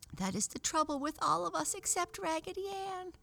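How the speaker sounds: high squeaky penny doll voice; squeaky little doll. Acted. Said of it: "cried the little penny doll in a squeaky voice".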